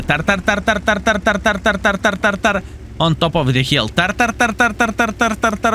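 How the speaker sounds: high cawing tar tar tar; crow impression. Player imitating a crow at an enemy Hawk, high cawing. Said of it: "That was the best impression".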